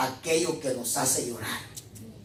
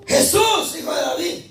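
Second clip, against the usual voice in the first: high, loud imitated cry; grito imitado; 13 semitones higher, 4 dB louder than usual. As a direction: call out like the blind beggar, high then easing. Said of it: "Dice que gritaba". (grito agudo del ciego, imitado)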